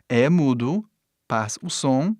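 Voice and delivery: normal talking voice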